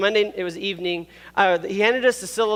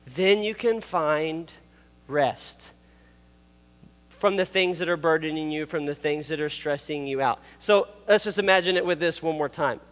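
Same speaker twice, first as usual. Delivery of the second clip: ordinary talk, nothing marked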